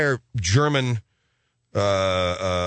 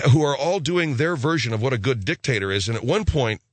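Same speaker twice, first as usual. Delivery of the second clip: ordinary talk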